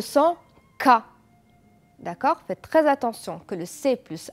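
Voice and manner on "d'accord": ordinary talk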